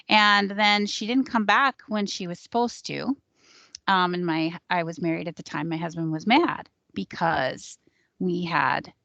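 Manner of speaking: usual speaking voice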